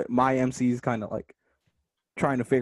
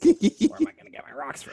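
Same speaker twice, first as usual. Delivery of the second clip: laughing crackhead voice; 3 dB louder than usual. Acted.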